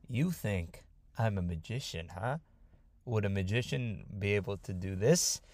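soft, low-pitched challenge after a chuckle; menacing taunt. Acted. Mysterious man answering the magician accusation, quietly menacing. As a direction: quietly dare him, dangerously amused.

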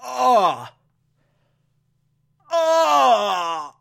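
cringing ugh; disgusted groan. Real, not acted. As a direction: groan like it hurts to watch. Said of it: "It's so awkward".

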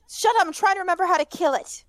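high, fast, flustered retort; urgent snapping. Acted. Girl to complaining friend while thinking, urgent snap. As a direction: rush it out at a high pitch. Hermione snaps back under pressure.

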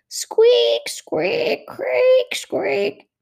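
very high squeaks imitating sandals; squeaky shoe noises. Sandals squeak as she walks.

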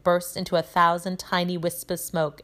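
ordinary delivery, nothing marked

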